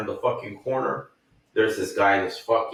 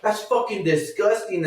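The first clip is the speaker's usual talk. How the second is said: much higher, scornful voice; disgusted stranger; 9 semitones higher than usual. Retold or imitated. A man in the restroom comments, high disgusted voice. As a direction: sneer it out as the other man. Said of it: "and then the".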